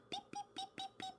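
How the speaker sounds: very high, soft beeps; quick little peeps, far above talking pitch; tiny bird beeps. Acted. Friend said I eat like a bird, tiny high beeps.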